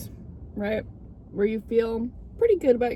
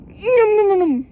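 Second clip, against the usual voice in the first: high, loud munching sounds; happy nom noises; 9 semitones higher, 8 dB louder than usual. Real, not acted. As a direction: nom nom with glee, pitched up. Eating the whipped cream.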